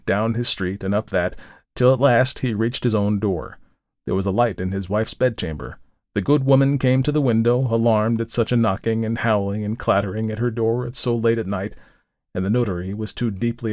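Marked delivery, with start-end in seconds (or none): none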